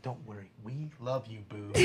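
very soft, tender cooing; hushed pretend comfort; mock consoling. Comforting insulted boobs, soft mock-tender reassurance.

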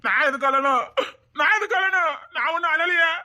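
rising high, choked protest; tearful denial. Acted. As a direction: deny the tears while close to crying. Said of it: "நீ அழுவுதியா".

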